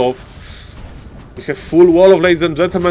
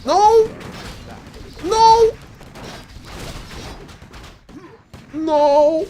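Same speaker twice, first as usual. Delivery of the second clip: anguished shouts; 13 semitones higher, much slower than usual. Real, not acted. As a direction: howl it as the unit dies. Caster despairing at lost unit.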